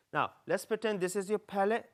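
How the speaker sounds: usual speaking voice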